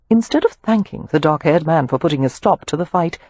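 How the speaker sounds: plain reading voice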